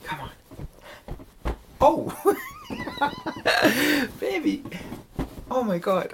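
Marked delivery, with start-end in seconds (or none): 2.4-4.1 very high shrieking laugh; squealing laugh. Real, not acted. Hit by her pillow, a high squealing laugh.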